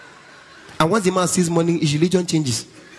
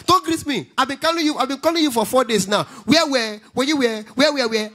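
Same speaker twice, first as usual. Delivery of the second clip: high-pitched scolding caller; pitched-up mock telling-off; V P Osinbajo; 5 semitones higher than usual. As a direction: imitate an impatient official on the phone. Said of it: "This is V P Osinbajo".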